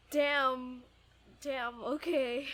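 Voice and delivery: ordinary talk, nothing marked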